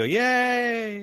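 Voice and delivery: loud, high mock cheer; crowd cheer. Thirty onlookers cheering the fulfilled prophecy, high and loud. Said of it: "everyone kind of cheers".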